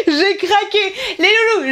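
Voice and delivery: high, giddy outburst; overexcited. Real, not acted. Vlogger confessing her splurge to viewers, overexcited and high. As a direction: burst out high, giddy with excitement. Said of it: "un peu trop excitée".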